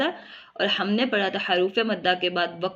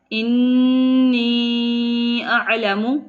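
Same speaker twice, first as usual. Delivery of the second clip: slow, drawn-out Quranic chant with held vowels; Quran recitation; 2 semitones higher, 4 dB louder, much slower than usual. Shown as a demonstration. Teacher reciting the verse for students, slow tajweed chant. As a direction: recite it slowly like tilawat. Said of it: "teacher recites verse in tajweed".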